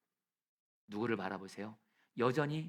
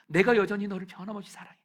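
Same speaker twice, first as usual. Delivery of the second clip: high, loud declaration of love; loud assurance; 8 semitones higher, 9 dB louder than usual. Pretend. Jesus assuring Peter of his love, loudly. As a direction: proclaim the love with force.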